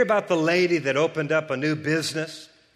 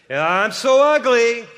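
louder, higher whiny self-pity; mock self-pity; 8 semitones higher, 9 dB louder than usual. Pastor mocking a self-putdown, higher and louder. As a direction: whine it like you mean it. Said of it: "if you're always saying".

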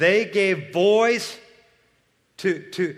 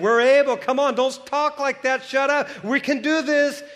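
bold encouragement; 7 semitones higher than usual. Retold or imitated. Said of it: "they were saying".

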